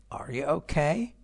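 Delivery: rising question tune up to "okay"; rising intonation. Shown as a demonstration. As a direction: lift the pitch steadily on "okay". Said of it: "صداتون میره بالا".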